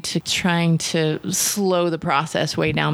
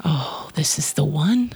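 thinking aloud; 5 semitones lower than usual. Retold or imitated. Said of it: "And I'm thinking".

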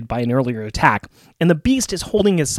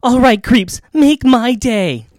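higher, louder, very slow tough-cop line; Dirty Harry; 8 semitones higher, 4 dB louder, slower than usual. Acted. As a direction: drawl out the movie cop challenge. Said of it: "he is not Dirty Harry Callahan".

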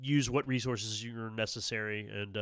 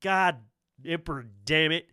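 higher, louder frustrated cursing; cursing driver; 5 semitones higher, 8 dB louder than usual. Acted. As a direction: swear out loud at the wheel. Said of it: "He's like".

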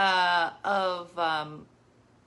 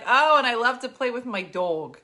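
loud, higher Jersey imitation; mock Jersey accent landing on "dog"; 3 semitones higher, 7 dB louder than usual. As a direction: imitate a Jersey girl, rounding "dog" into "dawg". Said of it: "We're in Jersey".

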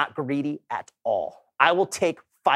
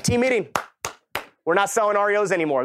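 excited announcement; 6 semitones higher than usual. Retold or imitated. Announcing change to his team.

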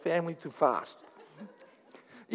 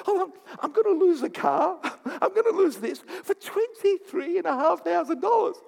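laughing self-quote, raised pitch; 11 semitones higher than usual. Retold or imitated. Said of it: "And I go".

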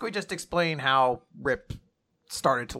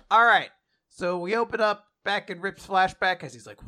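ordinary talk

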